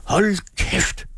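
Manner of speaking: high, sharp outburst; acted angry roar. A narrator's reading. Man hit on his sore point, high angry roar. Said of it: "brølede Carlson".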